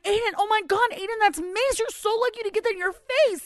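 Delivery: high, urgent mom alarm; retold freak-out. Mom reenacting telling son it's mace, freaking out. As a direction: warn your child in a high panicked rush. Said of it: "And I'm freaking out".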